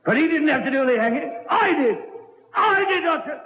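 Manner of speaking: a killer's triumphant confession getting wilder; manic confession. Acted. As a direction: gloat it out, building to a manic peak. Killer confesses, rising frenzy.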